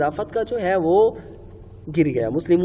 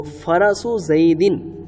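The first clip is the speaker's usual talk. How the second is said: very slow, deliberate Arabic phrase; slow model phrase; much slower than usual. Shown as a demonstration. Teacher giving students the finished Arabic translation, slow model phrase. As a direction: sound it out slowly and firmly for learners.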